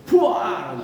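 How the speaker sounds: loud burst of buar; loud spit spray. Retold or imitated. Abuya Sarwani spraying the sleeping student, loud buar. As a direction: blast it out like spraying water. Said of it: "disambur sama Abuya Sarwani".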